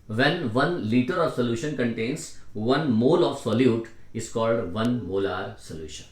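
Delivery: slow, stretched read-back of a definition; unhurried note-taking pace; slow dictation. Real, not acted. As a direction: dictate the sentence steadily and slowly. Teacher reading back the dictated definition.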